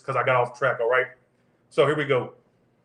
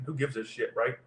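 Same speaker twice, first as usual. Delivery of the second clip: dropped way down, under the breath; muttered aside; 3 semitones lower, 8 dB quieter than usual. Real, not acted. Tarot reader muttering to herself mid-read, under the breath. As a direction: mutter it to yourself, tossing it off.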